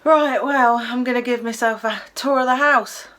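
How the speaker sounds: breezy Cockney announcement; broad Cockney accent, cocky. Acted. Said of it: "in a broad Cockney accent".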